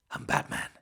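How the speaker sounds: hushed Batman whisper; Keaton Batman whisper. Retold or imitated. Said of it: "Keaton just".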